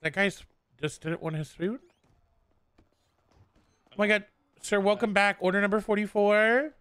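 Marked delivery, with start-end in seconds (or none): 3.9-6.7 higher, louder cashier call across the counter; calling out the order. Acted. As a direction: sing out the order number like a cashier.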